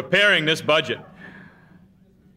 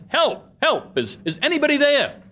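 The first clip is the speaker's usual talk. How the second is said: high desperate shout, much higher pitch; yelling for help; 11 semitones higher than usual. Retold or imitated. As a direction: call out for help from a cliff, panicked. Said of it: "So he started yelling".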